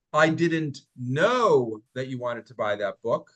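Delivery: know lifted and stretched, slow delivery; stress on know. Shown as a demonstration. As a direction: lean into know as if surprised. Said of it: "listen to how I say these".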